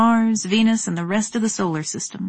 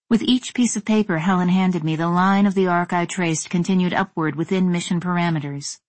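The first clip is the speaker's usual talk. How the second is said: ordinary delivery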